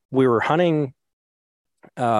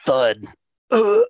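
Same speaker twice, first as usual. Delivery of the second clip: groan of a fall; 6 dB louder than usual. Retold or imitated. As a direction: yelp as you hit the ground. Second fall, groan again.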